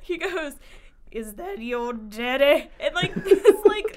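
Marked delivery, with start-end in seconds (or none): 1.1-2.7 put-on slow, creepy old customer voice; really weird voice. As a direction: imitate a weird old man, drawing the words out. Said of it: "like, really weird voice".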